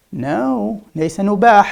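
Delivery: ordinary talk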